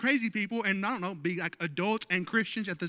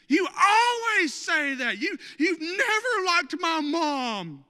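high, slow crying shout of a hurt spouse; mock upset wife; 9 semitones higher, 7 dB louder, slower than usual. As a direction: wail it like a wounded wife, half laughing. Mock spouse quarrel in sermon.

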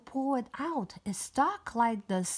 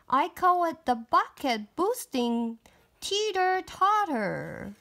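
bright princess voice; 7 semitones higher than usual. Acted. Princess character dialogue.

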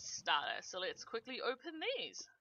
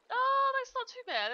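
surprised; 8 dB louder than usual. Real, not acted. Surprised by good loot roll.

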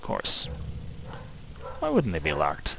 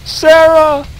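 loud high call across the room; shouting a name; 12 dB louder than usual. Real, not acted. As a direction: yell the name to reach her. Calling louder to character.

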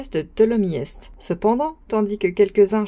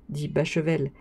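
ordinary delivery

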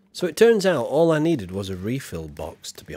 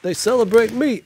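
put-on loud, high American-accented boasting; brash American Guy Fawkes; hammed-up American accent; 5 semitones higher, 5 dB louder than usual. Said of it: "American accent for Guy Fawkes".

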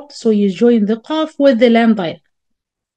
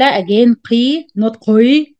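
normal talking voice

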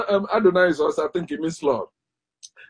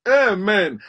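ordinary delivery

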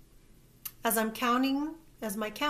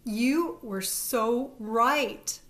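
slow, drawn-out call over the rain; yells while running; 2 semitones higher, 6 dB louder, much slower than usual. A narrator's reading. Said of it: "Brandy yells as she runs".

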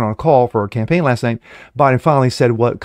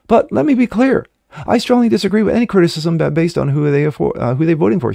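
pitched way up, a lightly mocking female voice; mocking Kamala quote; 6 semitones higher than usual. Host mocking Harris's clarification in a high voice. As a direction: read her words back in a sneering high imitation.

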